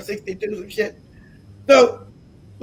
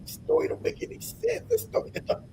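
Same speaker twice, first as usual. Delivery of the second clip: whispered inner voice, much softer; thinking to himself; 12 semitones higher, 12 dB quieter than usual. Retold or imitated. Speaker recalling his doubts about the story, whispered thought. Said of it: "I'm thinking to myself".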